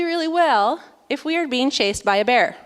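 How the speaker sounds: ordinary talk, nothing marked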